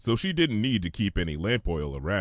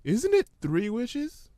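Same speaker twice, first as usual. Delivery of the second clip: much higher, gentle pony voice; Fluttershy; 14 semitones higher, slower than usual. Acted. Fluttershy answering the hummingbird, high and gentle.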